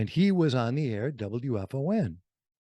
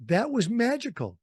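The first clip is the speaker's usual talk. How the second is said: higher and livelier on "magical"; bright; 8 semitones higher than usual. Real, not acted. Remembering the Santa show, lifted and delighted. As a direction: say it with a lift of delight. Fond memory of radio idols.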